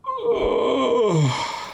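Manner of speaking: long high falling "oh" of mock grief; mock-sad wail. Thinking of what he'd do for money, mock-sad wail. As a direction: moan a drawn-out, high "oh".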